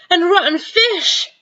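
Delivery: high, slow gasped words; gasping. Acted. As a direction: choke it out. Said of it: "she gasped".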